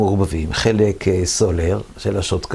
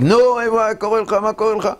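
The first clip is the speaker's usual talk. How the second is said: much higher, strained yelling voice; retold yell; 12 semitones higher, faster than usual. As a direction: yell at him in frustration. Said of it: "רק צועק".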